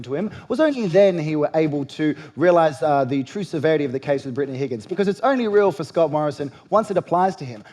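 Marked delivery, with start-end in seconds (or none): none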